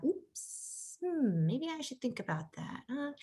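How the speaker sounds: put-on whispered little-girl musing, playful; a hint of a child catching her mother's mistake; imitating daughter. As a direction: softly voice the daughter thinking it over. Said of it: "And I see my daughter".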